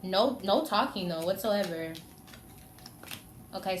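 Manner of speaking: ordinary delivery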